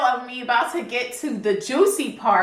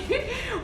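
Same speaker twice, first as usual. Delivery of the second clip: short laugh; 5 dB quieter than usual. Real, not acted. Laughing mid story.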